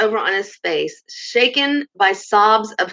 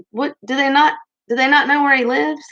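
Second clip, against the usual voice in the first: ordinary delivery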